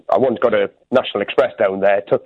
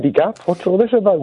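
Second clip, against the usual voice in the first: bemused surprise; 8 semitones higher than usual. Retold or imitated. Bemused first sight of shop.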